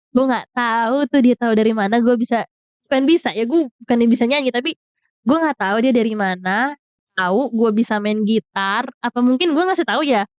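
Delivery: usual speaking voice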